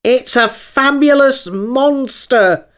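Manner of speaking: high, loud, drawn-out exclamation; excited unicorn cry. A narrator's reading. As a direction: proclaim it like a grand discovery. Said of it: "the unicorn cried out".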